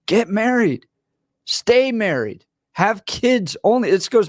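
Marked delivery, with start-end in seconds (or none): none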